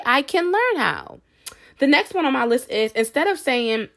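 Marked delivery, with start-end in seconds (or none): 0.0-1.2 higher, slow, bright-toned vow; hopeful affirmation. Shown as a demonstration. Vlogger modeling the self-talk to viewers, slow and higher. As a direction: say it slowly, believing it.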